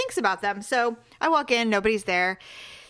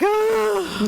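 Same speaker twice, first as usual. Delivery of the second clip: groan; 11 semitones higher, 4 dB louder than usual. Retold or imitated. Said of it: "He's like".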